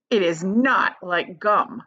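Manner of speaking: "not" slow and firm; stress on NOT. Acted. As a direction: insist slowly on "not". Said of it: "said Mister Wonka".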